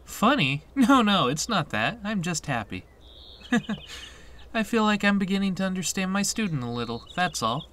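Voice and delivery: high, lilting cheerful reassurance; amused teacher. Acted. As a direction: explain brightly with a little giggle midway. Voices amused teacher in game.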